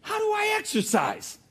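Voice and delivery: very high, pleading voice; mock whiny plea; plaintive question. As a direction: whine the question to God. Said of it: "I said".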